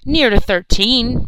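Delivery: loud, high, drawn-out indignant retort; angry. A narrator's reading. Charlie answering the teasing soldier, angry. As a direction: push back angrily at being belittled. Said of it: "replied Charlie angrily".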